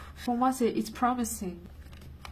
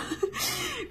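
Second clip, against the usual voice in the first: very high short giggle. Real, not acted. Reader giggling at the hug message. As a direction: giggle at the cuddle idea.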